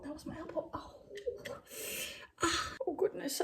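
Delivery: high, very soft voice squeezed by real pain; small, hurting voice. Real, not acted. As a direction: wince it out after hitting your elbow. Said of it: "Oh, that really hurted".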